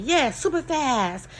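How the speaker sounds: high, loud eager kid imitation; excited child. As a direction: echo it like a thrilled little girl. Retelling excited child's reply.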